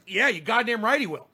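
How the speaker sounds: usual speaking voice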